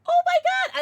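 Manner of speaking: thrilled squeal. Real, not acted. Gasp at good news.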